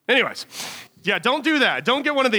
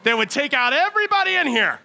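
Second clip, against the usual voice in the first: sadistic glee; 7 semitones higher than usual. Retold or imitated. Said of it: "you're over here like".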